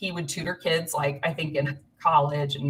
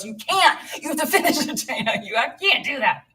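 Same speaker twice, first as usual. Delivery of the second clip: high, loud, laughing through the husband's protest; giggling while imitating his panic; 7 semitones higher, 4 dB louder than usual. Imitating her husband begging her to finish, laughing.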